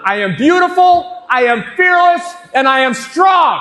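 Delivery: high, loud chanted shout. Shown as a demonstration. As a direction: call it out strong and high to the crowd. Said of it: "Little louder".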